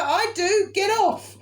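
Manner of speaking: high, loud, laughing get off; laughing shove-off; laughing retort. Retold or imitated. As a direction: push him away laughing. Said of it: "And I was like".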